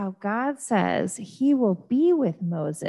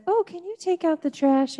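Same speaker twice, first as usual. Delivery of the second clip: a mother's request pitched much higher; mock mom; 9 semitones higher than usual. Mom asking kids to take out trash, lightly voiced. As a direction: mom asking a child to do a chore, in a lightly acted voice. Parent's chore request acted out.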